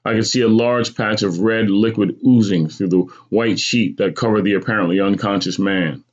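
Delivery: ordinary delivery, nothing marked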